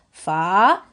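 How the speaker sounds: "fa" loud, with a long rising vowel; blended sounds. Shown as a demonstration. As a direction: draw out the syllable "fa". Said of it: "je vois feu avec A".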